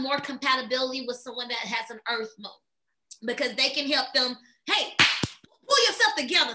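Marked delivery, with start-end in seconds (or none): 4.7-6.5 lightly voiced firm nudge, a bit louder; mild mock pep talk. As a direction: snap a friend out of it, half-joking. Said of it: "type of energy".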